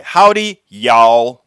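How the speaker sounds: mock Texan greeting with a big pitch swing from Howdy down to y'all; Texan accent. Shown as a demonstration. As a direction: play up the Texas twang on Howdy, y'all. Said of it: "That's how we say it here in Texas".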